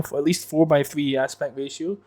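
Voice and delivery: usual speaking voice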